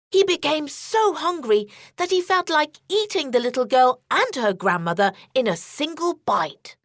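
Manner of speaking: plain reading voice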